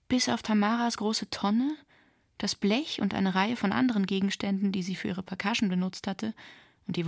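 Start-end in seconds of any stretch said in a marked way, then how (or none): none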